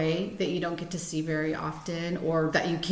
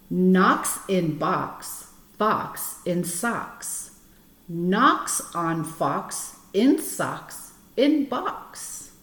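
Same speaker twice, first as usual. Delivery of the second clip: slow, deliberate tongue-twister reading; reading to children; slower than usual. Acted. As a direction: read each rhyme out carefully for the kids. Reads Fox in Socks aloud.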